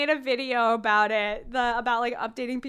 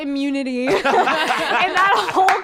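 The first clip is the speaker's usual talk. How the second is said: louder, laughing through the words; 3 semitones higher, 8 dB louder than usual. Real, not acted. Friend joking about sickly Welsh, laughing loudly. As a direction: giggle out the punchline.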